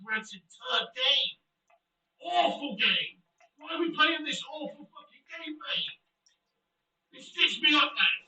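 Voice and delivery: loud, high, slow cursing at the game; furious shouting. Real, not acted. Streamer cursing the scripted game to his chat, furious shouting. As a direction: hammer out each insult in disgust.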